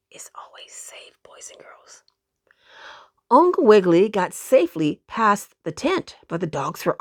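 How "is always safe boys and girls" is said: soft reassuring whisper. A narrator's reading. Narrator soothing listening children in a whisper. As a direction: whisper it to calm the kids.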